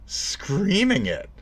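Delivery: SCREAMING high and long; slow, high-pitched stress on SCREAMING; exasperated emphasis. Real, not acted. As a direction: stretch SCREAMING out in frustration. Exasperated repeat about being ignored.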